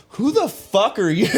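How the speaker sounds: loud, high, annoyed shout; indignant yell at someone tapping your shoulder; mock angry yell. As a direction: snap it at whoever tapped you. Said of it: "and I go".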